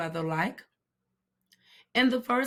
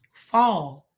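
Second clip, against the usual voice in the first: ordinary delivery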